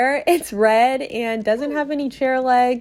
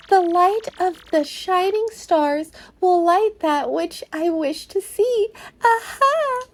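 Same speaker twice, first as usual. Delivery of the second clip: higher, grand mystical fortune teller voice; Merlovely the fortune teller; 7 semitones higher than usual. Acted. Fortune teller greeting Mario, higher and grandly mystical. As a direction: proclaim like a theatrical seer.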